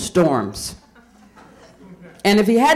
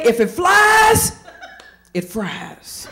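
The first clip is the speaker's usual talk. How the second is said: folksy saying; 7 semitones higher, much slower than usual. Retold or imitated. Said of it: "we say".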